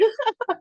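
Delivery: embarrassed laugh through tears; teary laugh. Real, not acted.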